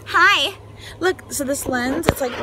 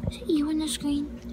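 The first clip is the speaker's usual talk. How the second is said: usual speaking voice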